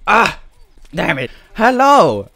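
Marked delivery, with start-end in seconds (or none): 1.5-2.3 taunting hello. Real, not acted. Taunting an enemy on return.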